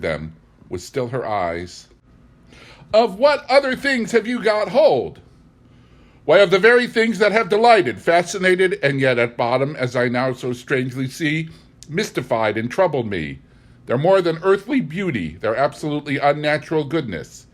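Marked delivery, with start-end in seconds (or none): 2.9-5.2 much higher, louder, anxious; the housekeeper pressing in fear; alarmed question. A narrator's reading. 6.2-17.5 louder, higher, feverish conviction; passionate outburst. A narrator's reading. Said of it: "the breath of my passion".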